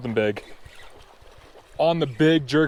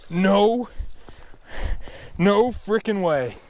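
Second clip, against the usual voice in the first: disbelief; 5 semitones higher, much slower than usual. Real, not acted. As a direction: shout it out, can't believe it. Fish landed, disbelief.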